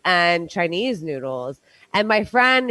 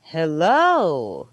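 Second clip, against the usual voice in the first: low pitched hello; 6 semitones lower than usual. Shown as a demonstration. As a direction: drop "Hello" into a low register. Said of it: "but at a low".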